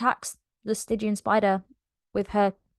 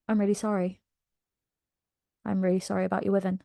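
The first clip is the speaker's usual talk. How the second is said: soft apology; 7 dB quieter, much faster than usual. Retold or imitated. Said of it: "Manon goes over".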